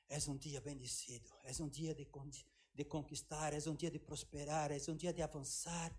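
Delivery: hushed prayerful proclamation; quiet declaration. Shown as a demonstration. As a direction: murmur the daily declaration. Morning declaration over the day.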